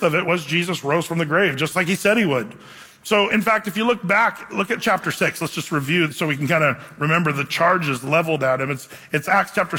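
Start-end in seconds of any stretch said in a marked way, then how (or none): none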